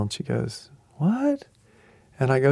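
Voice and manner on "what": high, rising disbelieving what; a startled what as it sinks in; surprised what. Retold or imitated. Elderly fan hearing Mark's name, surprised what.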